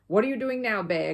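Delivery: loud suspicious growl. A narrator's reading. Mr Curry, watching the bear peer in his ear, growls loudly. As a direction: bark the question at the bear. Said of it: "growled Mister Curry".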